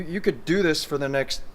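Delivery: ordinary talk, nothing marked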